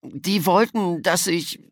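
high, slow, breaking off unfinished; slow, distressed child voice; tearful faltering. A narrator's reading. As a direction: struggle to confess, then give up. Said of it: "Die Tränen liefen ihm".

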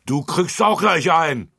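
high, loud threat; threatening shout. A narrator's reading. Lutz shouts a threat at Bärbel, loud. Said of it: "schrie Lutz und drohte".

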